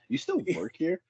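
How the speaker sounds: light teasing disbelief; mock surprised coworker. Safety guy imitating a colleague surprised he still works there, high and soft.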